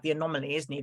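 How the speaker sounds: usual speaking voice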